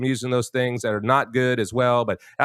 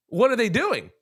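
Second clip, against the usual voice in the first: normal talking voice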